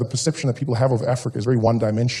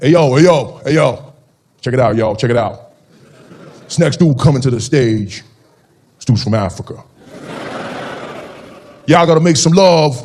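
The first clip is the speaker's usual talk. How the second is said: hype MC; 5 dB louder than usual. Retold or imitated. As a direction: pump up the crowd like an emcee. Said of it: "he's like".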